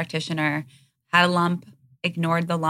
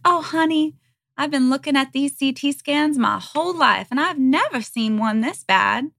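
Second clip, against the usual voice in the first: put-on mother's Southern accent; 9 semitones higher, 4 dB louder than usual. Daughter imitating mom seeing her scans, matter-of-fact Southern accent. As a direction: put on mom's high Southern lilt. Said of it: "had a Southern accent".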